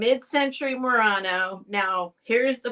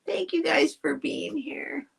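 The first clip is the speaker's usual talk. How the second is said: choked-up thanks, higher and thin on breath; emotional; 6 semitones higher, 4 dB quieter than usual. Real, not acted. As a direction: thank them while getting emotional. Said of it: "I'm so emotional".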